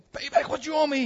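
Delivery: high-pitched, acted angry demand. Servant choking his debtor, high acted demand. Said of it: "he demanded".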